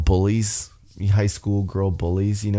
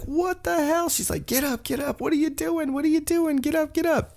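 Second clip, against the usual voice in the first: very high, squeaky mock panic; shrill-free high pitched panic impression, pitch way up; frantic Carmella; 4 dB quieter than usual. Carmella yelling at knocked-out ref.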